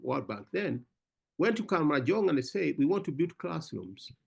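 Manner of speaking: usual speaking voice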